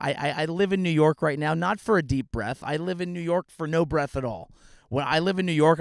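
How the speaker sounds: usual speaking voice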